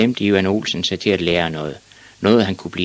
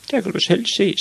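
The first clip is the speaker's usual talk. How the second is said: put-on very high little-boy voice; reproachful boy; 14 semitones higher, faster than usual. A narrator's reading. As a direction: complain to the father as a small son.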